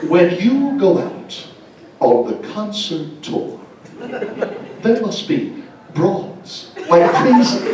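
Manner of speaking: very slow, drawn-out Shatner cadence; Shatner's drawl. Retold or imitated. Shatner asking the rock star about women on tour, slow and drawn out. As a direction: stretch every word like Shatner. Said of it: "This is what he said, word for word".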